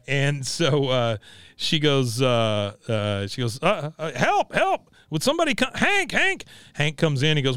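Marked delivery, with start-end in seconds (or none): 4.1-6.4 high, reedy mock cry for help; calling for help. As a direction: call out helplessly from bed.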